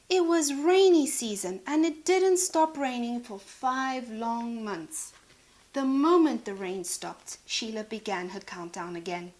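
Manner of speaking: ordinary delivery, nothing marked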